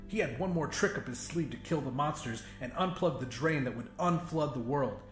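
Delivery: usual speaking voice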